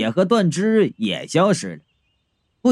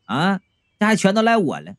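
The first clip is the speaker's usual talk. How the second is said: ordinary delivery, nothing marked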